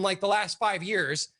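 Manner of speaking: normal talking voice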